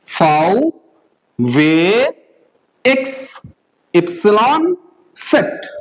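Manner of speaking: फाउ, वे, एक्स, एप्सिलॉन, सेट spaced one by one; German letter names, फाउ and वे held long; German alphabet recital. Shown as a demonstration. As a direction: model फाउ to सेट slowly for repetition. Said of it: "मेरे साथ साथ दोबारा बोलिए".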